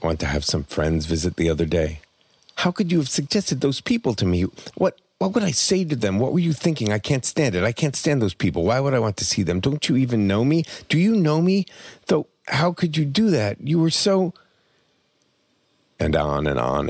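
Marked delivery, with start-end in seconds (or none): 2.6-14.3 a high-pitched, mock-furious rant, held up; rage torrent. Dying mother rants at her son, high-pitched mock fury. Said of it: "A rage torrent at my father".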